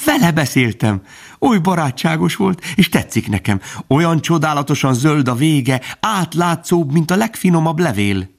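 put-on high-pitched, enthusiastic young fawn; Bambi. A narrator's reading. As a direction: gush to mother about a new friend. (magas, lelkes gyermekhang)

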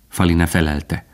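usual speaking voice

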